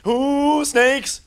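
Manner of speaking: high loud ooh snakes; loud high startled yelp; alarmed squeal. Real, not acted. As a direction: squeal it in fright. Spots more snakes.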